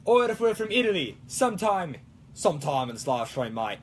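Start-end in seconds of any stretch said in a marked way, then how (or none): 2.3-3.8 put-on slipping into Australian accent. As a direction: lower Australian-accented aside. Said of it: "you talk a little Australian like that".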